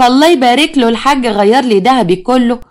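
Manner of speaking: slow, showy imitation of boasting; mocking mimicry. Daughter imitates stepmother flaunting her gold, mockingly drawn out. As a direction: mimic a show-off, drawing the words out.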